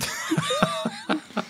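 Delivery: squealing high laugh. Real, not acted. Loud laugh.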